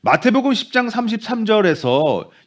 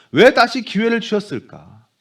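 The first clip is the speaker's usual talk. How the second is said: usual speaking voice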